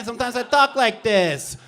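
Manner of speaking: high-toned comic character voice. Shown as a demonstration. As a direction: lift the whole line into an upper tone. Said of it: "Bobby Two Tones".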